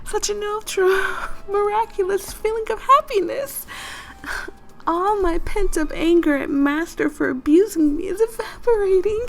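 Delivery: put-on high, swooning, blissful girl; ecstatic Toko voice. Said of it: "reading her voice".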